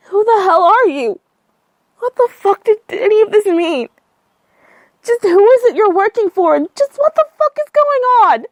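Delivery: high, loud furious demand; shrill-pitched angry outburst; angry shouting. Acted. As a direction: yell into the phone, enraged. Woman furious, demanding answers.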